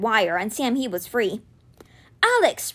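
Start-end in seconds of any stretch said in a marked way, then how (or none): 2.2-2.7 high-pitched urgent call; crying out. A narrator's reading. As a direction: call his name to rouse him. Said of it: "Rufus cried out".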